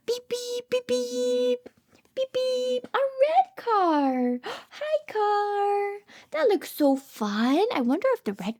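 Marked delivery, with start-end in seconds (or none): 0.1-2.9 high playful beep beeps; sing-song car horn. Pretend. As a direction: toot like a toy car. Car noises for children.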